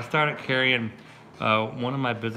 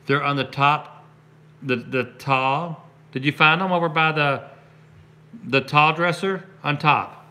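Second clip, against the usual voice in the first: calling out; 6 semitones higher, 7 dB louder than usual. Real, not acted. Answering his kid off camera.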